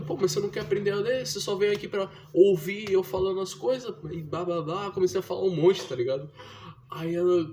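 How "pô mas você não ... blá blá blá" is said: retold scolding. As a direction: scold her like a fed-up big brother. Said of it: "eu falei".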